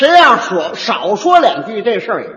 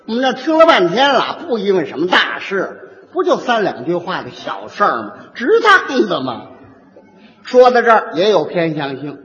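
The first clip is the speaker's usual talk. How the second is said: usual speaking voice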